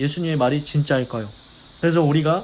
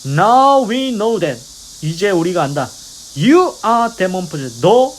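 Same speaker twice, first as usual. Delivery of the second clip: loud, drawn-out, slow mock shout; retold shouting; 5 dB louder, much slower than usual. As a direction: shout it slowly, stretching each English word. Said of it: "소리 지르면서".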